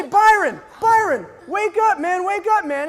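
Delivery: high, loud retold shouting; acted shout to wake someone; urgent wake-up call. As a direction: call out to rouse a sleeper. Urgently waking a passed-out friend.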